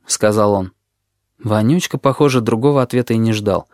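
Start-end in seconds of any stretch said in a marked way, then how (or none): none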